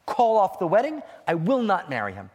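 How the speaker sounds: loud, firm bride's refusal; adamant refusal. Acted. Bride insists on cancelling.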